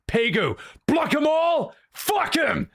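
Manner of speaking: high-pitched rallying yell; excited cheer. Real, not acted. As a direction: shout it like a hype man. Cheering viewer on loudly.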